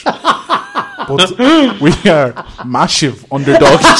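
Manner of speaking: loud mock-Dutch English, slushy S; hammed-up Dutch accent through laughter; Steve McLaren Dutch accent. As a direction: land it in a comic Dutch accent. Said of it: "Steve McLaren Dutch".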